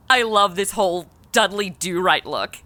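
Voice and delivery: usual speaking voice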